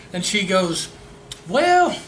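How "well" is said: put-on girl's voice. Woman answers his question.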